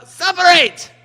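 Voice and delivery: sudden sharp yell; shouting. Pretend. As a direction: bark it to break them up. Toys pulled apart, yelling.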